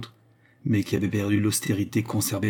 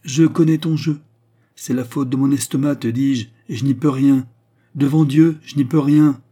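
loud, higher whining protest; agitated protest; 5 semitones higher, 8 dB louder than usual. Acted. Albert protests to his brother, loud and whining. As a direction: shout your excuses.

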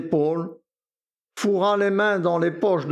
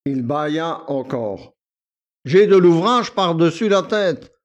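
bored valet; 6 dB louder than usual. A narrator's reading. Victor to Akoulina, bored and self-important. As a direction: complain carelessly, full of himself.

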